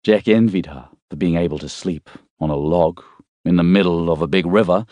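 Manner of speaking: ordinary delivery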